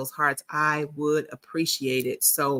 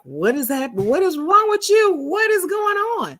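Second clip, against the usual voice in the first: much higher, louder mock husband in a panic; alarmed husband; 14 semitones higher, 6 dB louder than usual. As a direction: play the startled husband, pitch way up. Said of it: "my husband's like".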